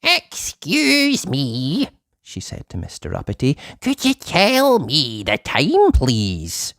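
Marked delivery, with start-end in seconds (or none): 0.0-1.9 high, very slow quavery lady voice; old lady. Acted. Old lady late for market asking Uppity, high and slow. 3.8-6.7 high, very slow quavery lady voice; old lady. Acted. Old lady late for market asking Uppity, high and slow.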